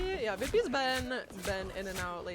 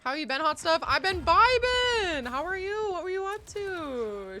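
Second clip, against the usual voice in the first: high, loud, bubbly greeting; sing-song excited hello, higher; cute anime streamer; 8 semitones higher, 9 dB louder than usual. Real, not acted.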